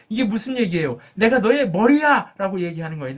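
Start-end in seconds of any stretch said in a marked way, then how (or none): 1.2-2.6 higher and louder, proclaiming 머리야; preacher booming God's words to Moses; God's bold declaration. Acted. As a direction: declare it like God claiming his servant.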